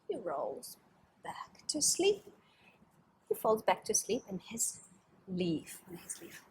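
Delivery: soft, sleepy whisper; hushed storytelling. Acted. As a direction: lull them, fading to silence. Drowsy story hush.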